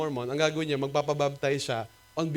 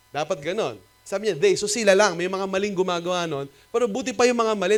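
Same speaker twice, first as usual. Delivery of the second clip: ordinary talk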